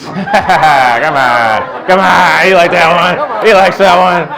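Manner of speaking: loud, high gloating over the crowd; triumphant shout. Real, not acted. Comic crowing to the crowd, loud and gloating. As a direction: crow over the joke that landed. Crowd cheers his mom joke.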